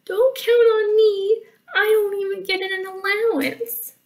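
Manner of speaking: high, whiny little cub; crying baby bear. Acted. Baby Bear protesting about allowance, crying in a high voice. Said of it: "Baby Bear cried".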